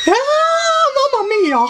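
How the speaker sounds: high Mario voice. Acted. Mario exclaims.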